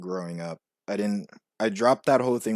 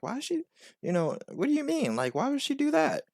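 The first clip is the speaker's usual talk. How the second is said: retold puzzlement, pitched much higher; bewildered questioning. Kid questioning mom about auntie's illness, bewildered. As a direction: ask it high, as a confused kid would. Retelling own childish bewilderment.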